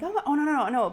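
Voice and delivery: high rising panicked denial; mock panic as it wriggles. Mom seeing the bug still shaking, high panicked protest.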